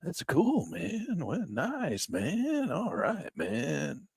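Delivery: a hint of a spaced-out hippie, drawn out; lazy stoner voice; hippie talk. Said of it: "do you walk around going".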